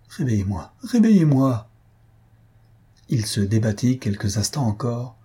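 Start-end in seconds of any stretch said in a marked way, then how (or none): 0.8-1.7 louder and higher, crying out; loud second plea to be woken; desperate cry. A narrator's reading. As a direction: throw the repeat out as a final desperate shout.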